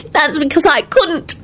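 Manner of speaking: high, slow tearful insistence; emotional, high-pitched protest; fierce. Acted. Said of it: "Solange said fiercely".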